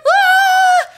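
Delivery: long, high, loud scream; acted scream. Rider upside down in the loop, terrified scream.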